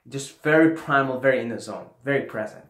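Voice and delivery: usual speaking voice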